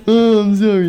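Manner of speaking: high, loud whiny sorry; exaggerated apologetic wail in a retold story; mock apology. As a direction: play up a put-on apology. Acting out scolded Spider-Man apologizing.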